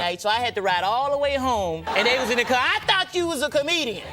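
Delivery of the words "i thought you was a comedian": high-pitched accusing jeer; mocking complaint. Brothers in the car to failed comedian, mocking complaint. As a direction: imitate a heckling relative, pitched up.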